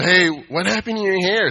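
high, grating taunting jab; a touch of mocking nasty teasing; annoying voice. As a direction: needle him like a little devil. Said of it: "in an annoying voice like that".

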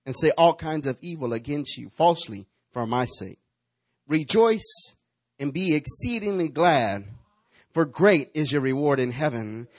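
loud, measured reading of the Beatitudes; scripture reading. Acted. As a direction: proclaim each blessing. Reads scripture aloud.

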